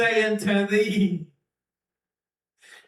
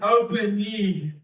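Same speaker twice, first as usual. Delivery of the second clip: ordinary talk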